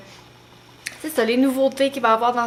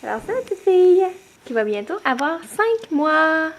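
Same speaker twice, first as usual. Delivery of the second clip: voix aiguë et chantante de maman, plus forte et rapide; parler bébé; 5 semitones higher than usual. Real, not acted. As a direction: gazouiller pour amuser sa fille. (maman qui parle à Sophia, voix de bébé aiguë)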